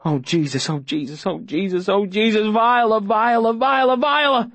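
rapid pleading climbing to a high cry; mounting panic. Acted. Boy helpless as she drowns, panic building to cries.

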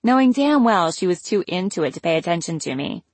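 ordinary delivery

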